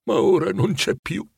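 a touch of grief, understated; moved. A narrator's reading. Scrooge telling the ghost of her loss, mildly moved. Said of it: "convenne Scrooge commosso".

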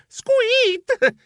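sharp high squeak of pain; mouse squeak. Acted. Mouse squeaks, tangled whiskers. (squittio acuto)

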